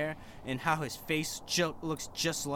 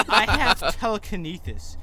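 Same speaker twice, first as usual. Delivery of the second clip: laughing mock cruelty; 5 semitones higher, 7 dB louder than usual. A narrator's reading. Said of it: "he answered cruelly".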